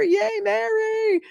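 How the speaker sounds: very high, drawn-out yay; long high cheer for a member; squealed cheer. Real, not acted. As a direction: squeal with delight, stretched slow.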